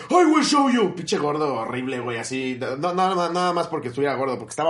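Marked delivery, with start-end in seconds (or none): 0.1-1.0 high, loud mock bellow; imitating the furious passenger, much higher and louder; mock threat. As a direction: bark the threat like a cartoon brute. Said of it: "Me dice".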